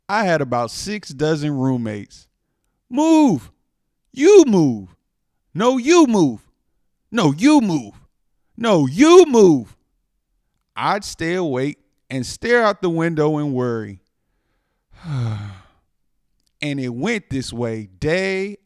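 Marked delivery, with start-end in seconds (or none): 2.9-9.8 high, loud shoving match of yells; bickering roommates. Acted. Cookie roommates in the jar fighting, loud high bickering. 15.0-15.5 sigh. Acted. Worrying at night.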